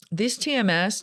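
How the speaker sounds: ordinary talk, nothing marked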